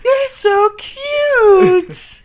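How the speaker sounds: high, slow sing-song coo at a cute tiger; mock cutesy baby talk. Cooing at a tiger, mock example.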